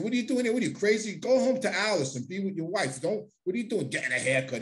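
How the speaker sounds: high, disbelieving scold; imitating John. John Gotti scolding him for getting a haircut, high disbelief. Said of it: "He said".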